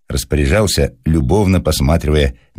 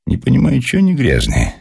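higher, puzzled musing; thoughtful; 10 semitones higher than usual. A narrator's reading. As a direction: wonder aloud, pondering it. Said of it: "говорил задумчиво Александр Семёныч". (задумчиво, с недоумением)

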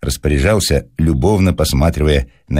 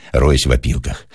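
ordinary delivery

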